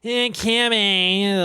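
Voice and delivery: imitating a slurry, dragged-out call to a friend; drawled Anna Nicole. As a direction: stretch it out lazily like a dazed starlet. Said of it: "and then she's like".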